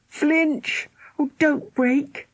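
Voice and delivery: high, slow, pleading; slow anxious plea. A narrator's reading. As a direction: beg it not to break. Tense family watching lamp fall.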